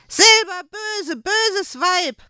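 hohe, langsame Koboldstimme, laut gebrüllt; brüll das Weib an wie ein wütender Kobold; brüllend. Acted. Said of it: "brüllte nun Erwing". (Erwing brüllt die Händlerin an)

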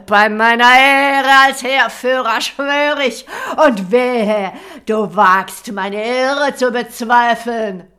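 loud, high, slow furious vow; angry warlord oath. Acted. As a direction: swear it like a threat. Warlord swears angrily.

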